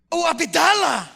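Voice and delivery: loud, high cry of sudden recognition; shouting a name the moment it clicks; joyful recognition shout. Retold or imitated. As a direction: call his name out in happy surprise. Old friend suddenly recognizes him.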